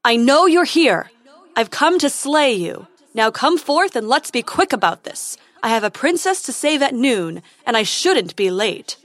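loud heroic challenge; calling out. Acted. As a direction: bold knight shouting into darkness. Prince calls out to dragon.

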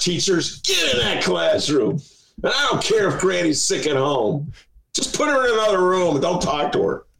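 hammed-up Mister Ed shouting at teachers; Mister Ed imitation. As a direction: play the talking horse bossing teachers into class. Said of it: "That's my Mister Ed imitation".

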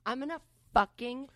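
slow, loud, word-by-word threat; retold furious vow. Sister told of her retold rage, vowing revenge. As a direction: punch each word as a threat.

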